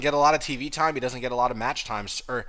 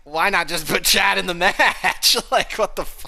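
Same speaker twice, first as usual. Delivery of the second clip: laughing disbelief; 7 semitones higher, 6 dB louder, slower than usual. Real, not acted. Laughing at the booking choice.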